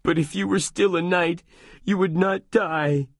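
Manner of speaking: a grieving squire pleading; Sancho sobbing. Acted. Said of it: "Sancho sobbed".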